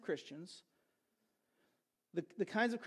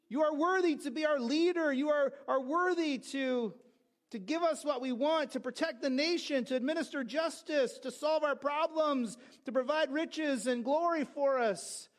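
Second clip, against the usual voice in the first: raised, higher-pitched chant of praise; herald's acclamation; 4 semitones higher than usual. Acted. People hailing the emperor as leader, proclaimed aloud. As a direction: proclaim each phrase like a herald's list. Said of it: "they would yell out".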